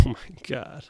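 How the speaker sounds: ordinary talk, nothing marked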